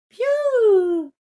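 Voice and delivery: long, very high, loud chick cry; chick peep. Acted. Poussine répond. (le poussin crie piou, très aigu)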